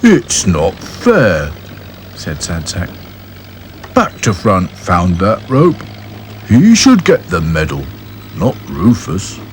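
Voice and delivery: very slow, sulky doll; glum Sad Sack. Acted. Gloomy doll protesting the medal, sulky. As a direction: complain mournfully.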